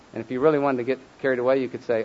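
usual speaking voice

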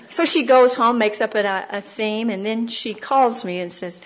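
ordinary talk, nothing marked

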